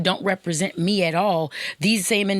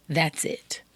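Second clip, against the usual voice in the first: whispered; 3 dB quieter than usual. Real, not acted. Podcast host to women listeners, in a whisper. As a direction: drop to a whisper.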